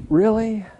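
hammed-up groan of disbelief on "really"; mock disbelief. Groaning at another year.

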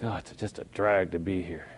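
imitating a bored pew-sitter; bored churchgoer. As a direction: sigh it out like a sulky churchgoer. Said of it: "sometimes it's like".

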